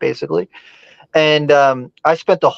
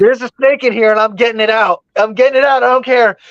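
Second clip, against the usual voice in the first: higher, eager, brushing off worry; excited insistence; 6 semitones higher than usual. Retold or imitated. To his frightened dad while digging for the snake, excited and insistent. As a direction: wave off his dad, too thrilled to stop.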